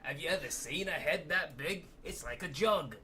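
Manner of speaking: exaggerated put-on British voice; mock British accent. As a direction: ham up a cheeky Brit. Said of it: "Lucy's accent".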